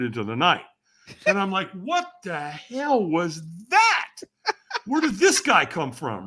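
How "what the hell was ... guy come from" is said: drawn-out, higher-pitched bewilderment; baffled disbelief. Retold or imitated. Storyteller reliving his reaction to the stranger, baffled. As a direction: react in amused, stretched-out disbelief.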